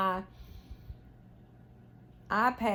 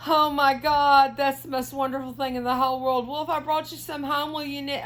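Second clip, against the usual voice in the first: gushing, high and loud at first, then racing; retold excitement; 6 semitones higher, 6 dB louder, faster than usual. Knitter retelling her reply to her brother-in-law, high and thrilled. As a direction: squeal it like you just heard the best news. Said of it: "And I'm like".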